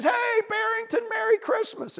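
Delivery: very high-pitched greeting; cheery beaver. A narrator's reading. Beaver pops up and greets bunny, cheerily high. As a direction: call out a hearty holiday hello.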